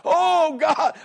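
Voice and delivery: high-pitched, long cry of prayer; preacher's impassioned imitation of a desperate prayer; crying out to God. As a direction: cry it out to heaven, acting overwhelmed. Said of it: "he's crying".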